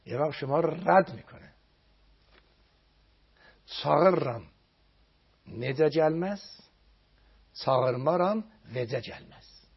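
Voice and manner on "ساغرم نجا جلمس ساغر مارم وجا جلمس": slow Azeri verse. Acted. Recites Azeri verse slowly.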